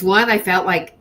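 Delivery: ordinary talk, nothing marked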